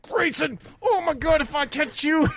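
high-pitched strained dad threat; retold furious threat. Injured dad reenacts threatening his son, angry. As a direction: threaten the kid, mock exasperation. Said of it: "I was like".

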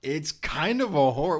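loud, insistent argument. Real, not acted. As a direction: push back hard. Arguing about anime genres.

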